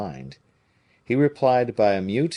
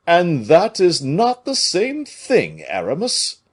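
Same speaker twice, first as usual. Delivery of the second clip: higher, louder, slow and grand; majestic; 9 semitones higher, 7 dB louder, slower than usual. A narrator's reading. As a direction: deliver it with lofty dignity. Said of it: "said Porthos, majestically".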